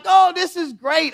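high, loud delighted cheer; excited kids. Retold or imitated. Kids at the party cheering Elmo, high and loud. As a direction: cheer like thrilled children.

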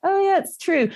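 lightly mocking, high "oh yeah" voice; mock agreement. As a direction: mimic their concession way up high. Voices people half agreeing.

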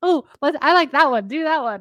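high, loud, eager egging-on; retold childish excitement. Woman replaying herself cheering on her third-grade friend, eager mimicry. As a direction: imitate a kid picking her favourite. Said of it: "I remember being like".

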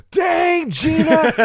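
high, loud exclamation; mock shout. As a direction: yell it out in surprise. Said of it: "to hear them laugh and to hear them go".

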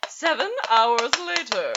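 slow, put-on narrator voice; mock time-card narrator. Announcing a time skip to listeners, slow mock narrator voice. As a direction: draw it out like a cartoon title-card announcer.

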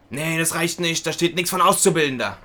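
dismissive clerk. Retold or imitated. Clerk rejecting the applicant's proof, mimicked insistent refusal. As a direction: imitate a clerk turning someone away.

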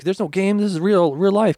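high, loud insisting; man realizing the shooting is real, shouting; panicked shout. Retold or imitated. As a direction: yell that this is real. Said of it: "He's like".

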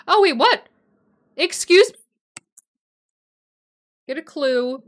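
loud, high-pitched startled questions; shocked, rising double take; indignant disbelief. Real, not acted. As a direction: react as if you cannot believe it. Startled by what the screen shows.

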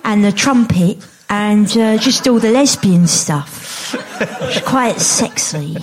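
put-on celebrity voice, slowing towards the end; Natalie Cassidy. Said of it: "favourite's got to be Natalie Cassidy".

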